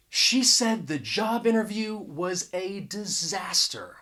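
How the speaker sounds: "a" as a full AY before "disaster"; emphatic article. Shown as a demonstration. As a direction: stress "a disaster", pronouncing "a" as "ay". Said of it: "I'm trying to emphasize".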